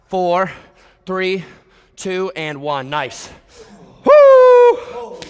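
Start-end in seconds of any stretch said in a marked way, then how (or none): 4.0-5.2 high, loud whoop of hype; excited whoop. Real, not acted. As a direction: whoop to fire up the room. Hype after the set.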